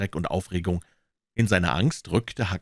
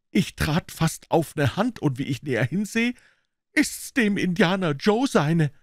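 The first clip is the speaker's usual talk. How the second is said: put-on scared boy; 11 semitones higher, 3 dB louder than usual. Tom voiced.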